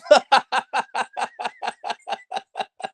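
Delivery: long high-pitched laugh. Real, not acted. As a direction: burst into a real, high laugh at the story. Laughs at the cop walking off.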